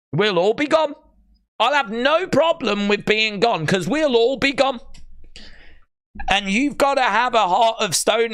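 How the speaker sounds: overwhelmed at the thought; emotional. Real, not acted. Emotional over imagined moment.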